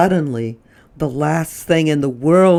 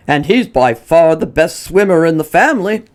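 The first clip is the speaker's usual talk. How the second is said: louder husband's voice, proud; Mr Prim adding; 3 semitones higher, 7 dB louder than usual. Acted. Mr Prim praises Lyle's swimming to his wife, proud and louder. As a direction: chime in proudly. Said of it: "her husband added".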